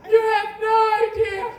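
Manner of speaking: high, loud, slow indignant mock rant; milk the childbirth card with outrage; mocking wife. Mocking women who play the childbirth card, shrill slow rant. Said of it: "that's their ace in the hole".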